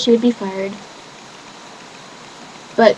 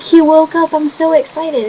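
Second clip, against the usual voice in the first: higher, louder happy news; excited; 7 semitones higher, 3 dB louder than usual. Retold or imitated. Telling her friend her brother woke up, excited and high. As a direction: bubble over with joy.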